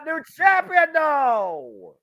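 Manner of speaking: high, loud yell; excited yell. Real, not acted. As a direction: shout it like the title changed. Near fall called live.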